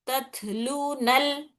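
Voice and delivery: तत्लूनल किताब drawn out very slowly, syllable by syllable; slow recitation demo. Shown as a demonstration. Teacher slowly recites the joined words for students. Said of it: "नल, लाम को नून से मिलाते ऐसा".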